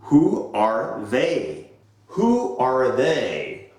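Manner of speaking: who are they, said slowly with stress on who; slow drilled question. Shown as a demonstration. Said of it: "Allow me to demonstrate".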